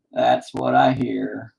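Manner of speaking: That's what I hear drawn out slowly; slow repeat. Shown as a demonstration. Repeating the joke slowly for the viewers. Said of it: "repeated line, slowed".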